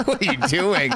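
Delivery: laughing through words; laughing in disbelief. Real, not acted. Laughing at a horrible cover.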